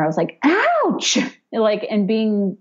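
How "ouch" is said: mock scream. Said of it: "I just remember screaming at her".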